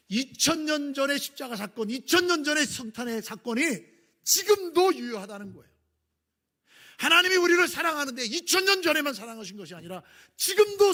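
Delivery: a preacher at full force from the pulpit; preaching at full force. Acted. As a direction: lift the congregation with conviction.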